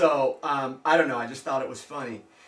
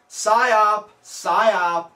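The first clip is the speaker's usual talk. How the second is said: emphatic call; 4 semitones higher, 9 dB louder than usual. Real, not acted. Loud emphatic label.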